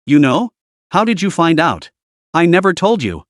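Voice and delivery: ordinary delivery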